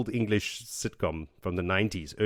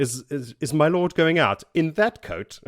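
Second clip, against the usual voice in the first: put-on posh-valet English accent, pitched up; high English accent; 6 semitones higher, 5 dB louder than usual. Imagining a manservant addressing his lord, high English accent. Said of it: "like, a high English accent".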